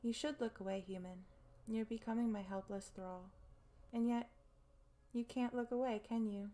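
ordinary talk

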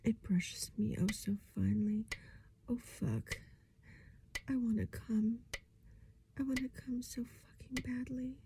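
breathy, straining whisper; desperate whisper. Acted. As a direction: whisper as if at the edge.

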